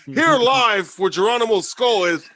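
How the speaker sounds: loud, high, slow on-the-scene announcement; mock live broadcast. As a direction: play a reporter calling out a live broadcast.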